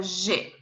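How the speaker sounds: G said "zhay"; French G, soft zh plus "ay"; French letter G. Shown as a demonstration. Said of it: "we have G".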